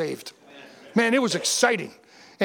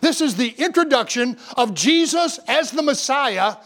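higher, emphatic pulpit delivery; preaching; 5 semitones higher than usual. Real, not acted. Pastor expounds the triumphal entry to his congregation, preaching. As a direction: preach it firmly, drawing out key phrases. Preaches and reads scripture aloud.

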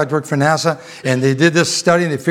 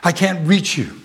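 ordinary talk, nothing marked

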